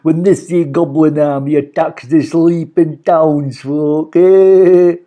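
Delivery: slow, gruff scheming villain; loud, drawn-out villain gloat. A narrator's reading. Mister Gum plotting, villain voice.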